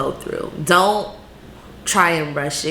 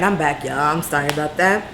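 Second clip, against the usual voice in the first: ordinary delivery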